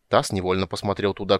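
ordinary delivery, nothing marked

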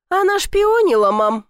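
loud, high tattling to mom; tattling shout. A narrator's reading. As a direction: shout it out, teasing her. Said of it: "хором сказали близнецы". (близнецы громко ябедничают маме)

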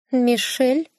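high suspicious cat query. Acted. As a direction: squint and ask like a wary cat. (высокий настороженный кошачий голос)

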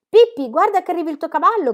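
calling out high and loud; excited boy's shout. A narrator's reading. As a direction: yell it out to Pippi. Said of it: "gridò Tommy".

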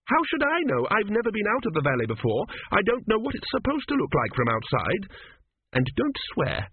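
very high-pitched, indignant heroine; indignant woman character. A narrator's reading. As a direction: retort high and exasperated as the offended woman. Voices Petracci retorting to Teppick.

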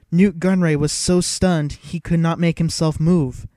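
ordinary delivery

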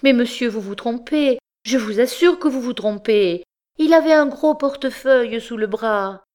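high, pleading wife's voice; upset, urgent protest; tearful insistence. A narrator's reading. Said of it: "Elle insista".

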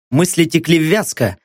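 ordinary delivery

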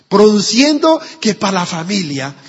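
ordinary delivery

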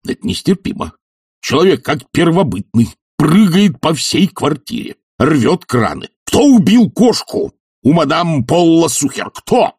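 high-pitched, mounting angry tirade; indignant tirade. Acted. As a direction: scold furiously, climbing to an outraged demand. Enraged professor scolds culprit.

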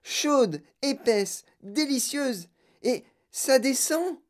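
high-pitched delight, savoring each word; excited raving. A narrator's reading. Girl tasting tomato soup in gum, excited. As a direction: rave over each taste in a high voice. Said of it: "hurla Violette".

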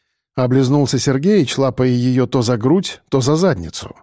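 plain reading voice

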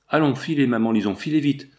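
ordinary delivery, nothing marked

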